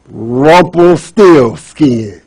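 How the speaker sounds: Rumpelstiltskin drawn out slowly; queen beats the elf, slow triumphant naming; triumphant reveal. Acted. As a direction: lay down Rumpelstiltskin syllable by syllable as the winning card. Queen finally says the name.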